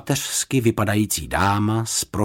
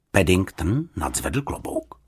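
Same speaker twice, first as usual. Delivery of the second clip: ordinary delivery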